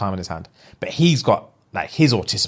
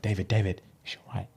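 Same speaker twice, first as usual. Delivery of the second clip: much softer, anxious murmur; worried hush; 4 semitones lower, 10 dB quieter, faster than usual. Retold or imitated. The nervous date guy quietly asking the producer, worried hush. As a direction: ask it under the breath, worried.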